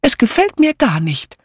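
high, insistent objection; fretful but emphatic old friend; unusually insistent Dora. Acted. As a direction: object firmly, pitched right up. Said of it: "mit ungewohnter".

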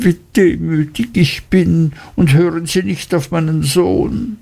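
put-on high, humble, wheedling old woman; pleading mother. A narrator's reading. Mother begs her son and the gentlemen, high and grovelling.

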